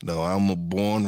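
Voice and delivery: ordinary delivery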